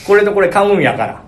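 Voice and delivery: forceful, high Kansai haggling; わざと強い関西弁. Retold or imitated. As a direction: push for a discount with deliberate dialect force. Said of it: "強く言おう". (強めの関西弁で押して値切る)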